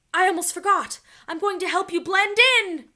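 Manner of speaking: loud, high shout over the wind; shouting above wind. A narrator's reading. Morgan shouting to the children above the wind, loud and high. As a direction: shout so they hear you over the wind. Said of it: "Morgan shouted above the wind".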